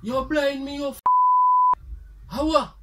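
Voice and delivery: loud, high, angry yell at his son; angry shout. Acted. Father interrupted at prayer yells at son, angry shout. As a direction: bellow at the boy for switching off the light. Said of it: "the man shout out".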